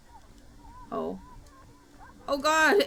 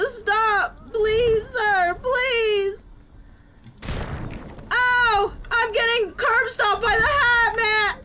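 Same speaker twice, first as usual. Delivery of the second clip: frantic begging; 6 semitones higher, 3 dB louder, slower than usual. Real, not acted. Being beaten, begging.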